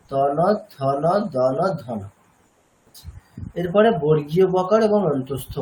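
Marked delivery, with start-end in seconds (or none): 0.1-2.0 ত ন, থ ন, দ ন, ধ ন, each with a dental ন after it; teacher drilling the dental ন; dental pair drill. Shown as a demonstration. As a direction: drill each letter paired with ন. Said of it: "অভ্যাস করব".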